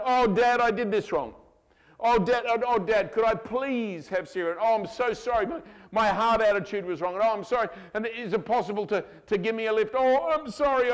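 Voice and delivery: high, pleading mock child voice; hammed-up grovelling kid begging Dad's pardon; apologetic child. Acts child endlessly apologizing to dad.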